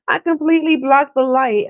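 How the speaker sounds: laughing loudly mid-sentence. Real, not acted. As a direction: laugh through the words. Laughing at own mistake.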